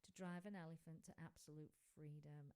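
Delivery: ordinary talk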